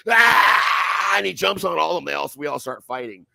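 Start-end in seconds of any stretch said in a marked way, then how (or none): 0.1-1.1 loud, high imitated battle yell; war cry. Imitating his crazy friend charging in, a loud high yell. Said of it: "my fucking crazy friend goes".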